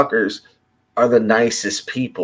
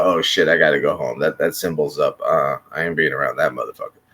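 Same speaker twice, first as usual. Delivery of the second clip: lower, fast and softer mock panic; scared onlooker; 8 semitones lower, 4 dB quieter, much faster than usual. Imitating people fleeing the Bat-Signal, hushed mock panic. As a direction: rattle it off like a spooked local. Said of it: "and not look up and go".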